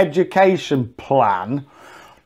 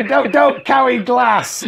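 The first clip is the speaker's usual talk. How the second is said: higher, slow shouted warning; mock warning shout; 7 semitones higher, slower than usual. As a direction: bark it like a stern safety film. Mocking safety film warning.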